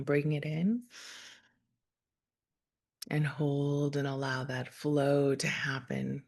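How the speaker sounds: calm, measured meditation voice with long pauses; guided meditation. Real, not acted. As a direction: gently guide listeners through breath and imagery, unhurried.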